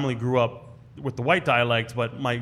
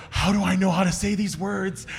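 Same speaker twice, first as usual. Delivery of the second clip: amazed wonder; 5 semitones higher than usual. Retold or imitated. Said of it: "I'm just like".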